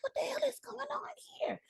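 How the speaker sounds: high, fast whispered disbelief; stunned whisper. Retold or imitated. Whispering in shock when a director follows her. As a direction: breathe it out, stunned. Reaction to Phil Lord following.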